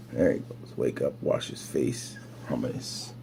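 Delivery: soft, low hushed commentary; hushed aside. Real, not acted. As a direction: murmur it under your breath. Quiet aside over video.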